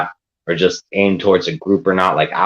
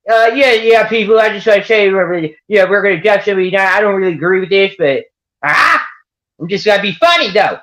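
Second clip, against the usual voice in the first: goofy southern voice; 11 semitones higher than usual. Shown as a demonstration. Mocking a troll who says cruel things in a goofy southern voice. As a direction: ham up a silly southern yokel. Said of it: "You said it in a southern voice".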